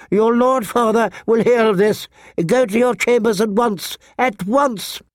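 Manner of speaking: high-pitched scolding governess voice; stern septa. A narrator's reading. Septa sends quarrelling sisters to their chambers, sternly. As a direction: order the children out as a scandalized septa. Septa orders the girls away.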